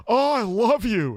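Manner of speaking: loud, high mock declaration; blurted out. Man imitating a hookup suddenly declaring love, blurted high and loud. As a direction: gush it in a high, drawn-out voice. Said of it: "she just blurts out".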